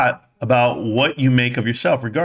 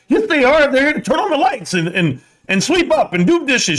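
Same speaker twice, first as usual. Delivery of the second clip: naive self; 12 semitones higher than usual. Retold or imitated. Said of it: "I was like".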